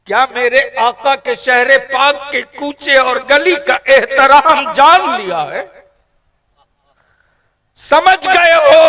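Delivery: high, loud, slow preaching cry; impassioned preaching. Acted. Preacher voicing the imam's rebuke to his student, impassioned cry. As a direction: stretch each word out to the crowd.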